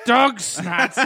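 laughing. Pretend. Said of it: "shanty pirate voice".

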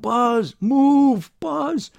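very high, loud warning cry; urgent shout. A narrator's reading. Driver shouts at Buzz as a semi comes barreling, urgent. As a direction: shout in alarm to get him out of danger. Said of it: "I wave my arms and shout".

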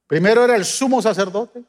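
measured preacher's cadence, rising on key words; sermon voice, steady and earnest; preaching. Acted. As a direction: preach it to the congregation. Preaches to the congregation.

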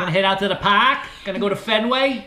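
fast Bostonian with dropped R's; Boston accent. Acted. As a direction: rattle it off like a Boston local. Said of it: "Drop the Oz".

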